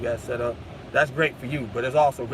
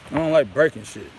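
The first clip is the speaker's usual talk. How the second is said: real frustration; 8 dB louder than usual. Real, not acted. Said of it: "I'm so mad".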